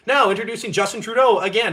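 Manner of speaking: high, loud mock announcer. Mocking the podium announcer introducing Trudeau, high and loud. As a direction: announce him with a hammed-up flourish. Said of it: "he's like".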